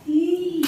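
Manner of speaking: ई stretched out as a long ee; long heavy vowel. Shown as a demonstration. Teacher repeats the heavy vowel for class, long ई. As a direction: sustain ई, giving it weight. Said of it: "भारी हो रहा हुआ".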